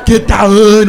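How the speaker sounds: hammed-up garbled scream, slow and loud; high, contorted Austrian-accented yell; Arnold Schwarzenegger. Comic imitates him warning of a bomb, mock Schwarzenegger scream. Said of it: "He starts screaming".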